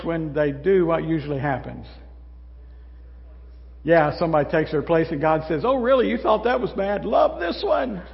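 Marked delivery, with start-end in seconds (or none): 5.6-8.0 lightly voiced amused God, high and teasing; a hint of God chuckling at you; God teasing the complainer, amused and high; playful God.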